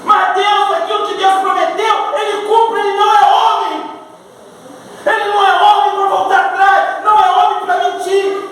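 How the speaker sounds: high, insistent pulpit declaration; forceful preaching. Real, not acted. Preacher assuring the church God keeps promises, forceful. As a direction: proclaim it to the whole church with conviction.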